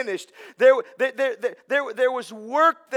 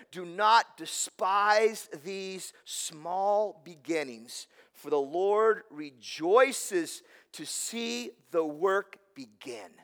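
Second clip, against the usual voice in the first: slow reading; 3 semitones lower, 5 dB quieter, much slower than usual. Acted. Reading scripture quote slowly.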